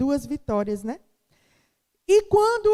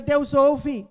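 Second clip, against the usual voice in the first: call to the church; 6 dB louder than usual. Real, not acted.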